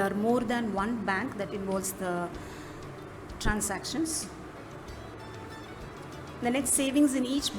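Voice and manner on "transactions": ordinary talk, nothing marked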